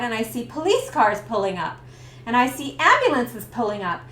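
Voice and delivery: ordinary talk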